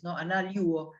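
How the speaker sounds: normal talking voice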